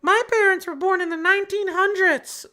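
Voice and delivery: high mock-kid whine about old parents. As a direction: mimic a bratty kid teasing old parents. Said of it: "our kids are gonna be like".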